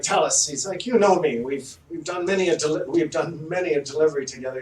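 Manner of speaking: cleric voice. Acted.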